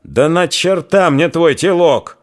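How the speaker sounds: slow, loud, high angry interruption; angry outburst. A narrator's reading. Old man angrily cuts off the rambling neighbour. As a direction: cut her off in a fury. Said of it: "гневно прервал".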